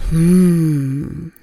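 contented mm with a nod; easy hum. Shown as a demonstration. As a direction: hum as if agreeing. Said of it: "mit dem Kopfnicken dabei".

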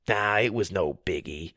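low, soft offhand shrug; downplay it coolly; playing it cool. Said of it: "trying to play it cool".

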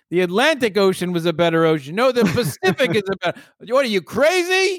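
hammed-up squabble, pitch climbing to the end; old radio-style argument. Host mocking their name debate, old radio-style argument. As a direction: play both sides, building to high outrage. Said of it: "an argument from, uh, like, Radio Day".

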